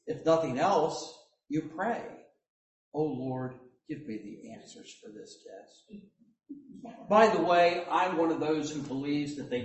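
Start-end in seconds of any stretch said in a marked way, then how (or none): 2.9-5.9 soft, unvoiced pleading; a student begging under the breath before a test; mock prayer. Student prays to the Lord for answers, whispered.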